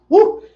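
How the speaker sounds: a high, loud woo after the shot; loud high whoop. Real, not acted. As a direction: cheer out after the drink.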